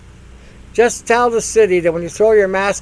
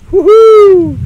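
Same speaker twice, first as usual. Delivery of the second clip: very high, loud falsetto woohoo; shrieked party cheer, very high; mock ecstatic whoop; 13 dB louder than usual. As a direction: whoop like you just climaxed.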